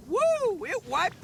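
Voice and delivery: a high, loud whoop; excited whoop. Acted. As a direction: cheer the winning beetle. Cheering the beetle's win.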